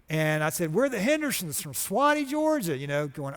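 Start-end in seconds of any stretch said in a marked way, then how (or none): 0.7-2.8 pitched much higher, bright and pleading; hope the guard lets you in; eager hopeful greeting. Retold or imitated. Greeting the Pixar security guard, pitched high and hopeful.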